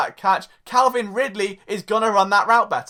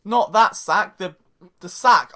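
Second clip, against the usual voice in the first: ordinary talk, nothing marked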